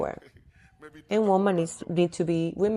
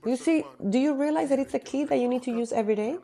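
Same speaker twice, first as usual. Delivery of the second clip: normal talking voice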